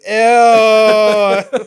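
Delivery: loud, high, long-held squeal of disgust; hammed-up Valley Girl "ew", stretched right out; Jimmy Fallon. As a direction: squeal it like a grossed-out teen parody. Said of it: "Jimmy Fallon that does that".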